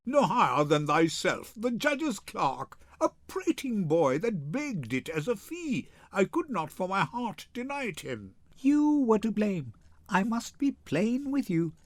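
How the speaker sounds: ordinary talk, nothing marked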